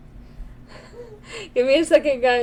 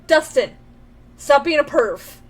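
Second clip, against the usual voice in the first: loud, mock scolding of Dustin; scolding the game character; 7 dB louder than usual. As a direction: tell him off playfully.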